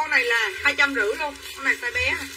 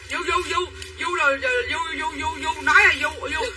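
mock English accent; 2 semitones higher than usual. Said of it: "để nói tiếng Anh cho nghe".

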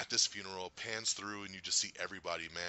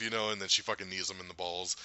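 ordinary talk

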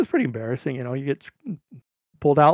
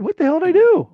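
high, loud retold outcry; indignant protest; 14 semitones higher, 6 dB louder than usual. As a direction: protest innocence, pitched way up. Protesting while being frisked.

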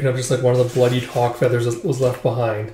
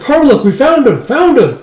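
excited; 11 semitones higher, 8 dB louder than usual. Retold or imitated. Excited news, rushing in.

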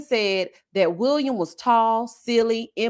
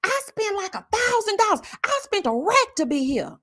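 mock scream; 10 semitones higher than usual. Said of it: "She's screaming".

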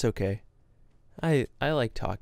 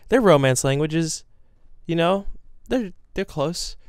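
flustered self-justifying; 6 semitones higher, 5 dB louder than usual. Retold or imitated. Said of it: "I was like".

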